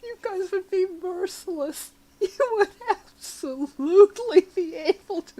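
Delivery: high, giggling through the words; can barely talk for laughing; helpless giggles at the chat; laughing hard. Real, not acted. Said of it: "because I'm laughing so hard".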